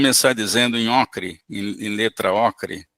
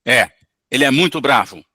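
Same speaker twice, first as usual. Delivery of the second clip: angry spirit; 6 dB louder than usual. Acted. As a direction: blurt it out like a cornered spirit. Spirit voiced, loud.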